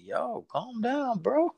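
high, soft, drawn-out startled protest; mock shock. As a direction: back off in a squeaky, taken-aback voice. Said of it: "I was kinda shocked".

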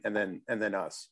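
ordinary talk

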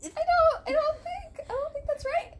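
very high, whiny doubt; high squeaky disbelief. Pretend. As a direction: squeal it in mock shock. Mock doctor reacting high-pitched.

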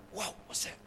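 hushed, breathy whisper of alarm; a shepherd boy whispering in surprise; startled whisper. Acted. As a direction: whisper it like you just heard something. David hears a lion roar.

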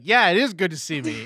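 loud, cocky teasing imitation; mock aggro comeback. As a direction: throw it back loudly, full of yourself. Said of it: "I'm so aggro".

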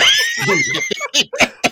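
very high, loud shrieking laughter; howling laugh. Real, not acted. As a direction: burst out laughing. Big laugh at the joke.

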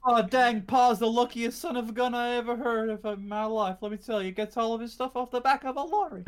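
high, twangy folksy boast; hillbilly accent. Retold or imitated.

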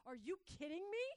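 high, loud mock disbelief; loud, high-pitched imitation of an outraged reaction; incredulous outburst. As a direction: act out indignant disbelief, pitch shooting up. Acting out woman's incredulous reaction.

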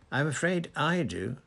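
courteous apology, voice lifted; apologetic. A narrator's reading. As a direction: break bad news with mock regret. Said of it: "said Dumbledore apologetically".

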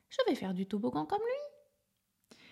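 high hushed eager plan; a small elephant whispering his idea; little elephant voice. A narrator's reading. Little elephant watching the lizard, high eager whisper.

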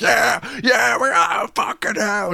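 high, hammed-up crowd whoop; a riled-up club member egging him on; rowdy cheer.

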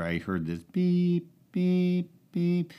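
high, sing-song beep beep imitation; beeping noise. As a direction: mimic a machine beeping. Imitating the coffee maker beeping.

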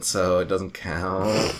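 put-on slow drawled valley girl. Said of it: "how I said that like a valley girl".

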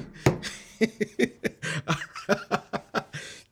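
breathless, unvoiced wheeze of a laugh; can't hold the laugh in; wheezing laugh. Real, not acted. A friend cracking up over his own prison joke, wheezing laugh.

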